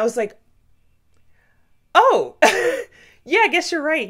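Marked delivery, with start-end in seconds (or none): 1.9-4.1 higher, louder, laughing it out; laughing realization. Real, not acted. Realizing she forgot to count herself, laughing embarrassed. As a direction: burst out laughing at your own mistake.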